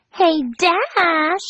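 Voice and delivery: high, sing-song call; piping Pinkie. Acted. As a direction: pipe up playfully. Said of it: "Pinkie piped up".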